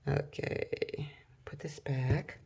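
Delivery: whispered aside to herself; whispering. Real, not acted. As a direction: breathe it out in a whisper. Muttering to herself while crafting.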